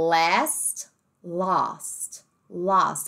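"last" then "lost" with a broad open a; open British a. Shown as a demonstration. Teacher showing the open British a in "last". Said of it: "o som do A no britânico, ele é mais aberto".

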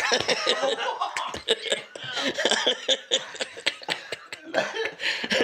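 long helpless high wheezing laugh. Real, not acted. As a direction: laugh till you run out of air. Laughing at the punchline.

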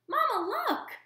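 high squeaky gosling cry; a little bird calling out in delight; squeaky child voice; excited squeak. Acted. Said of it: "he squeaked".

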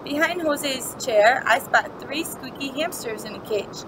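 usual speaking voice

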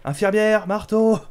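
high, loud sing-out to the nurse; playful calling out. Pretend. Player calls the nurse over for hammer blows, playful calling out. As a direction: holler it high in mock summons.

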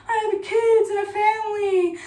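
high, tearful begging guard; a weepy hostage pleading for mercy; mock crying plea. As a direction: sob out the plea, hammed-up. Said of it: "crying or whatever".

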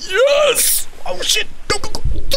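very high frantic cry; very high-pitched excited yelling; excited squeal. Real, not acted. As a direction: shriek at the screen in excitement. Frantic reaction to game event.

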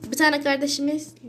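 ordinary talk, nothing marked

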